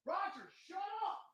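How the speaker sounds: distant high shout at the dog; far-off shout at the barking dog; yelling from another room. Real, not acted. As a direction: yell it from far off, off mic.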